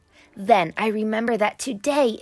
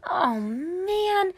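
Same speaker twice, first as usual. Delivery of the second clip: whiny protest; 10 semitones higher than usual. A narrator's reading. Danny protesting being interrupted.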